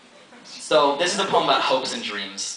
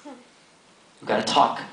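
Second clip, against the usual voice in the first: slam poetry; 6 semitones higher than usual. Acted. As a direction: deliver it like a slam poet. Performs a slam poem.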